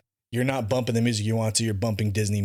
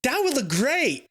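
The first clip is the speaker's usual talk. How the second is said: excited; 11 semitones higher than usual. Real, not acted. Excited retelling of dinner.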